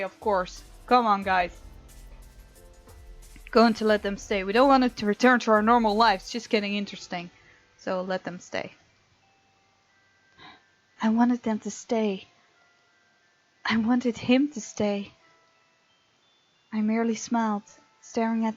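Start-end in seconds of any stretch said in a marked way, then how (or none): none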